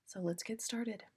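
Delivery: soft unvoiced whisper. Real, not acted. Whispering to listeners before the story. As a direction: whisper it in to start.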